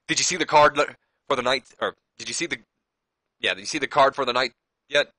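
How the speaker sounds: bursting to share the news; excited. Retold or imitated. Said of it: "He's all excited".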